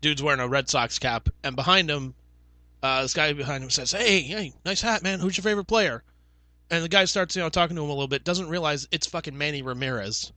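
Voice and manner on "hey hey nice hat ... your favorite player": higher, eager hey man greeting; chummy stranger voice; friendly call-out. Retold or imitated. Fan calls out to guy in Red Sox cap, eager.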